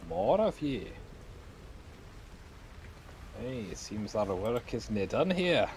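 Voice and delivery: put-on passable Scottish accent. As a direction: creature with a so-so Scottish brogue. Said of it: "In a passably Scottish accent".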